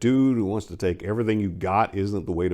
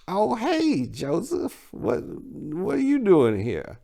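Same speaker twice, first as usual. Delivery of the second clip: higher, surprised sister, slowing down at the end; sister's voice; 10 semitones higher, much slower than usual. A narrator's reading. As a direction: put on the caught-off-guard sister. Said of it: "she said as she used the screen door".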